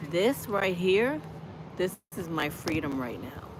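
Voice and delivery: ordinary talk, nothing marked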